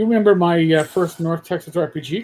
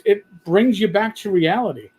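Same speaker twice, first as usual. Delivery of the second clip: ordinary talk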